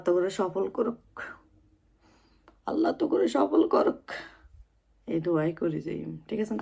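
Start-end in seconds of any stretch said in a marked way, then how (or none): 2.7-4.0 thin, very high repeat of the wish; put-on high little voice; high-voiced blessing. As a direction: lift the whole blessing far above your usual pitch.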